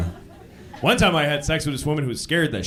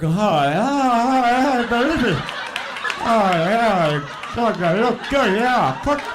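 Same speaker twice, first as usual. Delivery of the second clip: mock drooling at TV; 4 semitones higher, slower than usual. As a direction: egg the TV chef on like a starving fan. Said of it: "I can't watch the Food Network".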